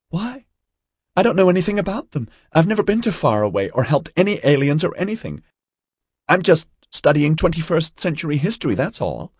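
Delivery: put-on high, bewildered girl's voice; high-pitched, hurried distress; distraught young student, protesting. A narrator's reading. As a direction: protest innocence in a high, upset voice. Narrator voices distraught young April.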